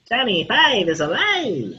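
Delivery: slow, drawn-out robot movie quote; Johnny Five. Retold or imitated. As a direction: quote the little robot slowly. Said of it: "be lucky if they can pull off Johnny Five".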